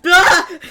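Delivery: sudden loud, high-pitched shriek; startled scream. Acted. Startled drow caught from behind, screaming. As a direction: scream as if someone crept right up on you. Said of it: "you hear Devon scream".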